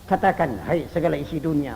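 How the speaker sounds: ordinary talk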